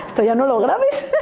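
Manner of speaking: real laughter running through the words; laughing. Real, not acted. Speaker joking with the person recording, laughing.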